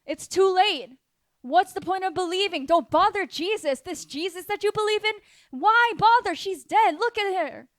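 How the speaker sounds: high-pitched jeering doubters; mocking. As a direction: taunt them as a scornful crowd. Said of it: "They're mocking you".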